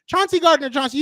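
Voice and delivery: emphatic loud name. Real, not acted. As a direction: throw the name at him. Heated argument, emphatic name.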